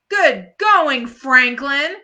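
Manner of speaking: mock praise; sarcastic complaint. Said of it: "Peppermint Patty complains".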